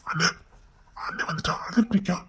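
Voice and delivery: fast hushed whisper; tense whisper at a door; anxious whisper. Acted. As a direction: whisper the news quickly. Anxious family waiting outside room.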